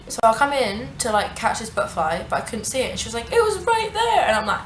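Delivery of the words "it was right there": high-pitched imitation of a flustered friend; mock indignant squeal. Friend insisting she saw the butterfly, high mock squeal.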